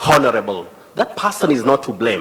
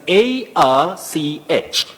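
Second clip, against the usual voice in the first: spelled out slowly; 4 semitones higher, slower than usual. Shown as a demonstration. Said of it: "the combining form".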